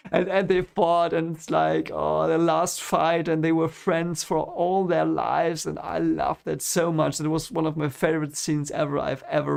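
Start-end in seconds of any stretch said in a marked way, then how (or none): none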